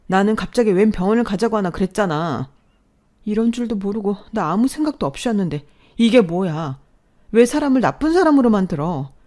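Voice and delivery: an angry daughter screaming at her sick mother; furious outburst at mother. Retold or imitated. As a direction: yell at her mother with everything she has. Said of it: "있는 힘껏 그렇게 엄마한테 ... 화를 냈어요".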